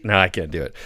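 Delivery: ordinary talk, nothing marked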